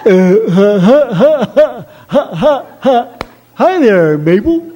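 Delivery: put-on goofy, dopey boyfriend with nervous laughs; dumb suitor. Mort greets Mabel at the door like a dopey fool. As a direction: play a clueless suitor giggling at the door. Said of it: "the love of your life says to you".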